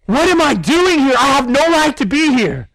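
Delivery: high, anguished cry of unworthiness; Isaiah's weeping lament. Acted. Prophet before holy God, crying out in high-pitched anguish. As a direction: wail it as if undone. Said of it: "he begins to weep".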